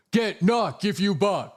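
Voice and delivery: high, slow put-on voice; mock catchphrase. Streamer chanting his silly catchphrase.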